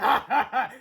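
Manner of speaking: short mocking laugh; gloating laugh. A narrator's reading. Mister Crump laughing at the boys, mocking. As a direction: cackle at them. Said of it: "laughed Mister Crump".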